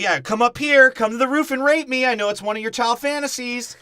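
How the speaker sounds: high, loud mocking taunt; taunting the kid from the street, hammed up; mock villain taunt. Podcaster voicing the movie burglar taunting the kid, in a high mock shout.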